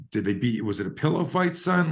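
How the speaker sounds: high, mocking pillow-fight question; teasing dad. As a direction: tease the son lightly. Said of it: "and he's like".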